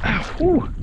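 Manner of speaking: sharp high yelp of pain. Real, not acted. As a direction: yelp as the line burns your hand. Burned by line, cries out.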